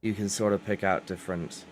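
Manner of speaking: ordinary talk, nothing marked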